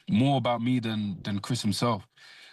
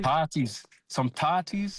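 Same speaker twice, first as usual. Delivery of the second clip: Scottish accent, pitched up, "tarties" said the Scottish way; 7 semitones higher, slower than usual. Shown as a demonstration. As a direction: say "Some tarties" like a Scot. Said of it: "Say it in the Scottish accent".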